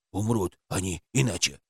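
low quiet odd creature; toy creature voice. A narrator's reading. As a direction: speak low like a strange toy.

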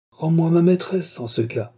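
high mocking opening, then dropping; sneering demand. A narrator's reading. Viscount demanding his mistress back, sneering. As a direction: taunt him with the demand. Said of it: "ricana le vicomte".

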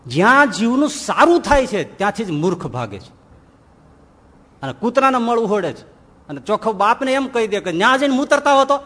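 usual speaking voice

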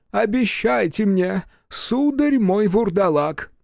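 high, slow plaintive appeal; pleading professor. A narrator's reading. Professor begging the vampire for a promise, pleading. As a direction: draw out the plea in a high voice. Профессор умоляет Бучило.

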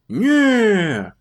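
loud, glad denial; glad reassurance. Acted. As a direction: reassure her gladly. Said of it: "радостно заверил её Пузан".

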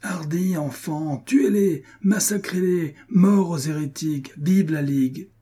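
high-pitched battle cries in short bursts; fervent rallying calls, high but only partly shouted; rousing the soldiers. A narrator's reading. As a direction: spur the troops on to the kill. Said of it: "la voix d'Hercule qui excitait ses soldats".

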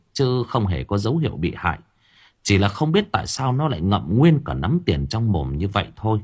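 plain reading voice

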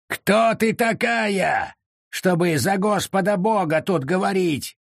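high, drawn-out indignant shout; angry yell. A narrator's reading. Ansel challenges the accused witch, an indignant yell. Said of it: "заорал в ответ Ансель".

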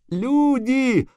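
high-pitched, urgent calling out; breathless cry. A narrator's reading. Cobbler calling the lords, breathless and high. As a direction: gasp out an alarm high in the voice. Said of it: "задыхался сапожник".

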